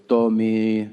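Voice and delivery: put-on low-pitched Peruvian-accented mom; mother's Peruvian accent. Mom phoning her son, in a Peruvian accent. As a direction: imitate a Peruvian mother's English. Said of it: "Why does she talk so fucking weird".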